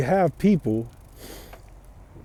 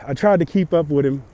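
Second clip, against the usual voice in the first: ordinary talk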